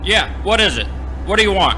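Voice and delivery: loud, irritated shout through the door; annoyed yell. Acted. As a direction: bark it at the late visitor. Owner roused at night, yelling.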